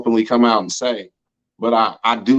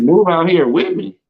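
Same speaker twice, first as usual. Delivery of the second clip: incredulous; 6 semitones higher, 4 dB louder than usual. Retold or imitated. Shocked at her moving-in offer.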